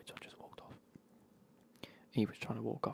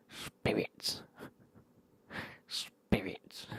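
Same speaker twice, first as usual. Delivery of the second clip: mock drunk bloke demanding booze; slurred drunk impression, breaking into laughter; imitated drunk; 4 semitones lower, much slower than usual. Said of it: "he's already fucking pissed as a fart".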